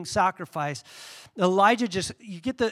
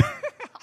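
high burst of laughter; 9 dB quieter than usual. Real, not acted. Laughs after the taunt.

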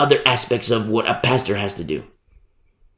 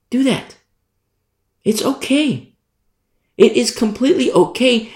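much higher, light permissive voice; high playful reassurance; 11 semitones higher than usual. Real, not acted. Preacher to congregation, high light permission.

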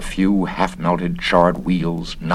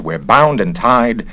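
usual speaking voice

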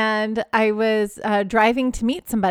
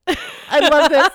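higher, louder, slow and delighted; excited; 5 semitones higher, 5 dB louder, slower than usual. Real, not acted. Host eager to hear her co-host's story, delighted and drawn out. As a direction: savor the words, drawn out and thrilled. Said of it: "eager for the story".

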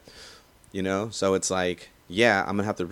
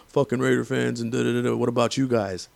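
mocking Niners fans; 4 semitones higher than usual. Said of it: "the first thing out of your mouths are".

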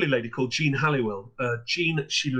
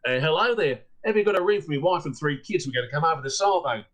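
put-on Australian accent; faster than usual. Australian caller asks the guest house owner for a room, light Australian accent. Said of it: "an Australian chap who said".

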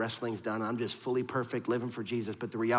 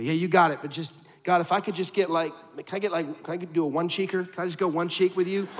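higher, louder wheedling plea; mock bargaining; 7 semitones higher, 7 dB louder than usual. Imitating a believer bargaining with God, a wheedling mock plea. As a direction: whine like someone haggling with God.

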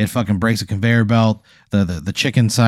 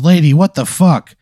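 raised, high-pitched angry outburst; yelling at someone; 7 semitones higher, 5 dB louder than usual. Acted. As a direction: play an owner telling off a customer. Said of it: "he's, like, yelling at the lady".

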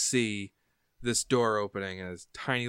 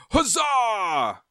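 high, loud acted cheer; guards' battle cheer; 11 semitones higher, 5 dB louder than usual. Guards answering Royster's speech, cheering. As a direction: cheer back like a band of rallied guards. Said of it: "the guards like".

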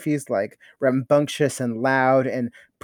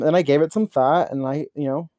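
ordinary delivery

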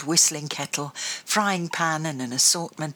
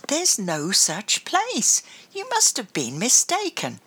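Grandma voice; 6 semitones higher than usual. Acted. Grandma repeats, slower and firmer.

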